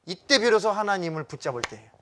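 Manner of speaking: ordinary talk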